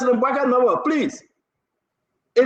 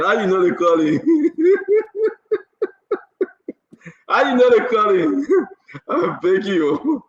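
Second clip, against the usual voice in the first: repeating it through real laughter; laughing. Real, not acted.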